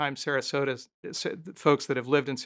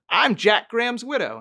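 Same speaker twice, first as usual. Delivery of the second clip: put-on pitched higher and louder, an imperious old lady; mock grand-dame challenge, higher voice; imitating an older woman; 7 semitones higher, 5 dB louder than usual. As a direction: play the haughty widow demanding recognition. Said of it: "She goes".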